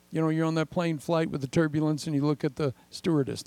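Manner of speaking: ordinary talk, nothing marked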